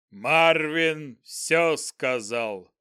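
very slow roaring goblin voice. Acted. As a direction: bellow it like a giant goblin. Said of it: "проревел гоблин".

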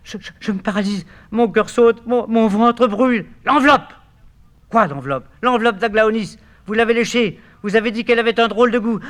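high, loud, breathless dying cries; panicked. Acted. Poisoned Eurydice begging for Orphée. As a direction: gasp out the pain and terror.

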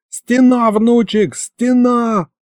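high, urgent cry from grandpa; alarmed warning. Acted. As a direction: sound the alarm to your grandson. Grandpa raises sudden alarm.

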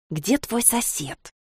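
slow, soft hiss in the ear; hissed whisper. A narrator's reading. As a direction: hiss it slowly under your breath. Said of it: "прошипела она мне в ухо".

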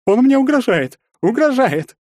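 very high, loud falsetto alarm; falsetto wailing. A narrator's reading. Bully telling onlookers he is threatened, falsetto wail. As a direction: cry foul in a piercing falsetto. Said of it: "фальцетом заголосил тот".

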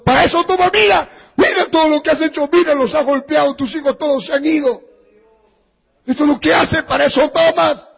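wife berating husband over the broken home; angry scolding. Acted. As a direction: rub his face in the damage. Mother scolding husband over damage.

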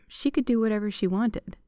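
ordinary delivery, nothing marked

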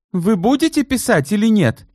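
finally out of patience; lost patience. Acted. Vereshchagin demanding she write, exasperated. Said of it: "потерял терпение и Верещагин".

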